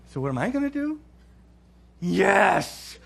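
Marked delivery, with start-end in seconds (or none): 2.0-3.0 high, loud emphatic shout; emphatic yes. Retold or imitated. Wife insisting the problem is his now, shouting yes. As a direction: bark out a triumphant yes.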